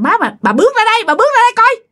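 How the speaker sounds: high, quick insistent summons; urgent high-pitched calling. A narrator's reading. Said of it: "Bà Hảo kêu rằng".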